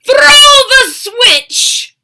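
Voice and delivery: high, loud, slow mad doctor; booming mad scientist command; mad scientist cry. Acted. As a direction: throw it like a crazed scientist. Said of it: "Doctor Franken cried".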